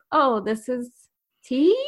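"tea?" swung up high as a question; puzzled, hesitant lift on "tea?"; questioning doubt. Retold or imitated. Recounting her letdown on tasting the tea, doubtful questioning. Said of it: "Question mark?".

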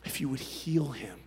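whispered plea that slowly gains voice; hushed, reverent re-enacted prayer; pleading prayer. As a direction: begin under your breath, begging God. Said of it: "I'm praying down".

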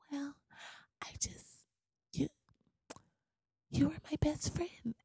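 imitating a sweet young girl in a high whisper; girl's soft whisper. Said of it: "She, she talk like this".

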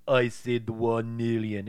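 slow, lightly put-on bad Spanish accent; a hint of a hammy Spanish villain accent, slow. Retelling the movie's Spanish dealer, slow mock Spanish accent. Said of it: "a terrible accent".

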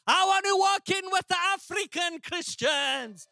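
high, drawn-out revival cry; imitating a German evangelist in full cry; exuberant preacher shout. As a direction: stretch it out high, building to a long hallelujah. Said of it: "singing and dancing and shouting".

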